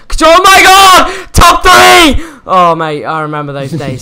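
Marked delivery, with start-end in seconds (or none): 0.1-2.2 shouting with excitement; excited shout. Retold or imitated. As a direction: yell it like reaching top three. Reliving first top three excitement.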